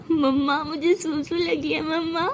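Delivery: slow, drawn-out mock toddler whine; teasing child imitation. Jais mocks Sara with the cousin's plea, teasing baby voice. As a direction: mimic a little kid to tease. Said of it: "ऐसे चिढ़ा रही होती है".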